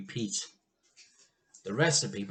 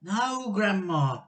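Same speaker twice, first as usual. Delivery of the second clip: put-on higher, urgent boy's voice; George protesting; 8 semitones higher, 5 dB louder, slower than usual. A narrator's reading. George tries to stop Grandma. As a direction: blurt it out in alarm. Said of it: "George said".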